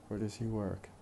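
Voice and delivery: "Where does he work?" linked, does and he weak; fast connected speech. Shown as a demonstration. Teacher pronounces examples for students, in fast connected speech. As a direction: run "Where does he work?" together, only where and work stressed. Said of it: "does and he are unstressed".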